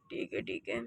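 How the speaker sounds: breathy whispered okay; whispered agreement at bedtime. Acted. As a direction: barely breathe it. Unvoiced reply.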